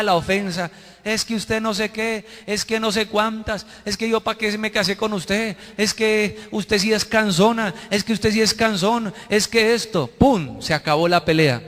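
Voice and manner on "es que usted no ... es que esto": higher-pitched nagging back and forth; singsong imitation of a domestic squabble; mock bickering couple. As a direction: reel off petty marital complaints. Acted couple's quarrel at home.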